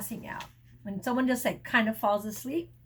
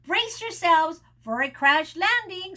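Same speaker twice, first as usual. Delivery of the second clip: louder warning call; 5 semitones higher, 5 dB louder than usual. A narrator's reading. Goose warning passengers before landing, raised voice.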